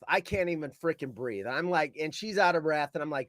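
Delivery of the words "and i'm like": ordinary talk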